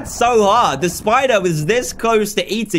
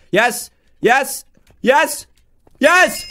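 mounting triumph; 6 semitones higher, much slower than usual. Real, not acted. Player nearing the parkour finish, building excitement. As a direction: separate cheers, each more excited.

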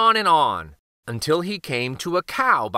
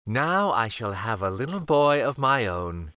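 a hint of the old woman, lower than the narration; lightly voiced old woman; 5 semitones lower, 4 dB quieter than usual. A narrator's reading. Little old woman thinking by the oven, lightly voiced. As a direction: think it to yourself, slightly lower, as the character.

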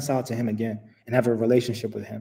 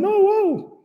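much higher, loud mock alarm; startled protest; 4 dB louder than usual. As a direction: yelp it like a shocked American. Americans' imagined reaction to law.

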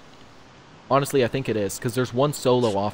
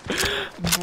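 high laugh. Real, not acted. Laughing at teammate's bad luck.

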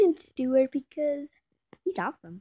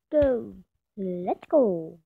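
ordinary talk

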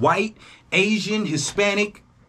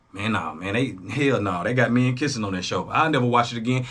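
lowered dismissive mutter; 8 semitones lower than usual. Retold or imitated. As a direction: lower the voice and wave it off. Voices himself dismissing a TV show.

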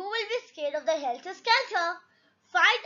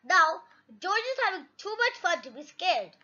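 ordinary delivery

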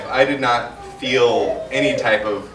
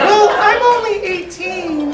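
put-on high girly voice; 4 dB louder, slower than usual. Retells girl's reply.

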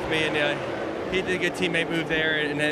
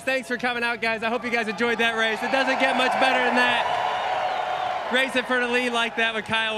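excited; 5 semitones higher than usual. Real, not acted. Winner's post-race interview.

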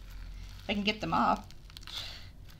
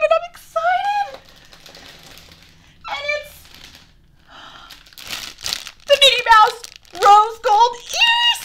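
overjoyed squealing; 10 semitones higher, 7 dB louder than usual. Real, not acted. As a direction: squeal it out like a dream came true. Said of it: "Sorry, I got a little excited there".

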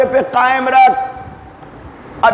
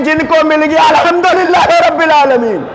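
rising preacher's proclamation; 3 semitones higher than usual. Acted. Climax of sermon contrast.

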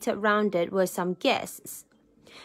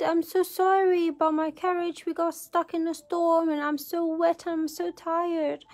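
higher-pitched, apologetic princess voice; pleading princess; 7 semitones higher than usual. A narrator's reading. Wet princess explaining herself at the door, pleading. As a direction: plead sorrily, high and weary.

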